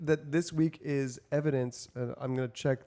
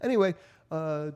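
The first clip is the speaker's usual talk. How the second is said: ordinary talk, nothing marked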